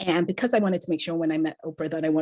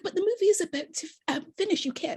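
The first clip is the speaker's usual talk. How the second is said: friend's protest; 12 semitones higher, faster than usual. Retold or imitated. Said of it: "And she was like".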